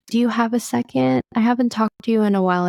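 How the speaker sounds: sweet, calm request. Retold or imitated. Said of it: "so sweet and so calm".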